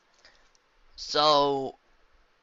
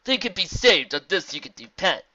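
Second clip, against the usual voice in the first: lightly voiced zebra, louder and higher; reassuring zebra; 7 semitones higher, 9 dB louder than usual. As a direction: assure them in measured rhyme.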